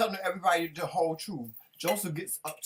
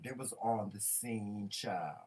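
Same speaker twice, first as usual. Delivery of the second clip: slow emphatic; 7 semitones lower, 6 dB quieter, slower than usual. Real, not acted.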